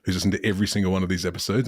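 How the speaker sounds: ordinary talk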